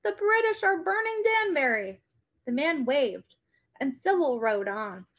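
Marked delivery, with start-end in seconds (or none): 0.0-2.0 higher urgent warning; cried out. A narrator's reading. Sybil warning the farmer, urgent cry. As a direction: warn them, pitched up. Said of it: "Sybil cried".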